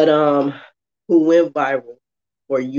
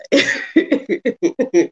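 laughing; 10 semitones higher than usual. Real, not acted. Birthday girl laughs.

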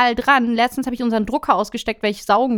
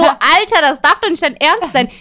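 loud, higher outraged disbelief; indignant disbelief; 6 semitones higher, 7 dB louder than usual. Retold or imitated. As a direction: protest the horse drawing, incredulous. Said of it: "dachte ich so". (lauter, empörter Unglaube)

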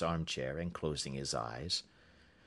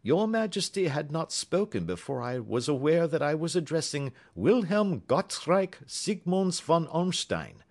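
a lightly voiced, higher, crisp detective; Holmes, cool and courteous, raised in pitch; 10 semitones higher, 5 dB louder than usual. A narrator's reading. Holmes introducing Watson to the visitor, politely.

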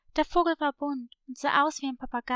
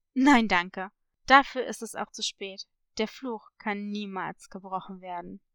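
grave parrot; 6 semitones lower than usual. Acted. Parrot refusing to help against the curse, grave. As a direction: refuse firmly, then sink to an ominous low close.

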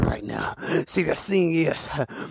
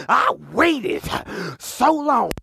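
longing man; 7 semitones higher, 3 dB louder, much slower than usual. Retold or imitated. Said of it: "A real man gonna be like".

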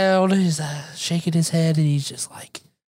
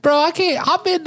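laughing; 12 semitones higher, 3 dB louder than usual. Real, not acted. Laughing through the words.

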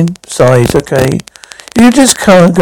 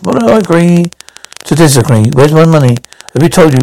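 usual speaking voice

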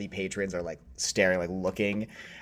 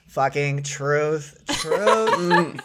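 loud, high, drawn-out tipsy cheer; hammed-up drunken agreement, higher and louder; drunk rich woman; 7 semitones higher, 5 dB louder, much slower than usual. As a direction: holler it slowly like a drunk socialite. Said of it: "one really drunk, like, rich woman".

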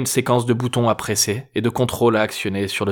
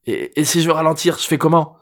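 high, anxious stammered question; nervous question; 6 semitones higher, 3 dB louder than usual. A narrator's reading. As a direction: ask it anxiously, stumbling. Tense driver asks, stammering.

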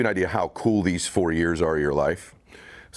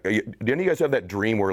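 normal talking voice

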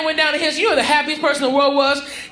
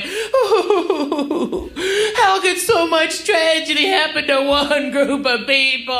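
mock crying; 2 semitones higher, slower than usual. Mocking a weepy TV special host, hammed-up crying. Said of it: "blathering idiot's crying".

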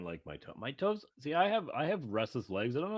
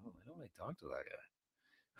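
much softer, muttering the reversal; muttered reversal; 3 semitones lower, 18 dB quieter than usual. Retold or imitated. He flips on a friend, softer sour mutter. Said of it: "And I'm like".

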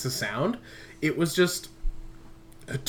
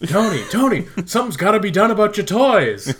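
high, loud, laughing nag; nagging voice; 8 semitones higher, 7 dB louder than usual. Pretend. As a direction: scold him about his toys. Voicing a scolding wife.

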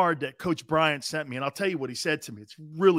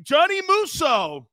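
loud mock coach; 11 semitones higher, 4 dB louder, slower than usual. Imitating Coach Bryant answering proudly.

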